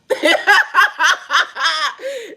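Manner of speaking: high laugh falling away; real long cackle. Real, not acted. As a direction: cackle high, then let it drop. Laughing at herself.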